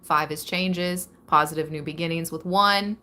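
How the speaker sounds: ordinary talk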